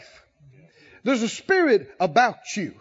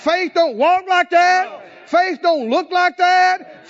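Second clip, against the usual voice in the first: high, slow, insistent preaching cry; emphatic preaching; 5 semitones higher, 4 dB louder, slower than usual. Real, not acted. Preacher correcting faithless talk to the congregation, emphatic. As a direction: drive each phrase home, pitched up.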